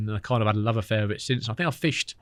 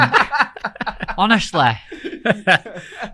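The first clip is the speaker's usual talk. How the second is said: burst of laughter; 10 semitones higher, 4 dB louder than usual. Real, not acted. Laughing at the fancying joke.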